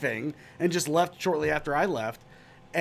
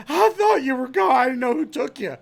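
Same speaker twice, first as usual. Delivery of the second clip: much higher, louder, panicked; frantic Joe; 9 semitones higher, 6 dB louder than usual. Retold or imitated. Joe explaining himself to Matt, high and panicked. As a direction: blurt it out frantically. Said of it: "And Joe's like".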